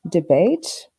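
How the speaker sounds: ordinary talk, nothing marked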